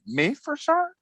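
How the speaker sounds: high squeaky disbelief; surprised squeak. Retold or imitated. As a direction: pitch jump up in shock. Ordered to wake everyone.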